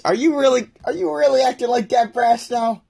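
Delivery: high-pitched, protesting disbelief; incredulous scolding. Real, not acted. Streamer calls out a chatter's behaviour, incredulous scolding.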